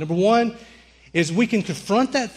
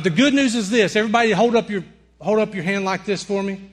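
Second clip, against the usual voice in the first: ordinary talk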